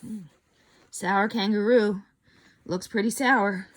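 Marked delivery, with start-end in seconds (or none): none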